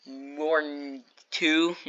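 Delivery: slow, low, dopey working-out; brain-dead mock. Mocking a girl doing math, dim and slow. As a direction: drag it out as if the brain stalled. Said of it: "full brain dead mode".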